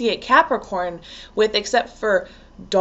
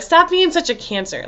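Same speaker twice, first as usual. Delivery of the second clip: higher-pitched imitation of a jeering tease; mock teasing scold; 7 semitones higher than usual. People making fun of Cancers, mock scolding. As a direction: needle them in a raised mock voice.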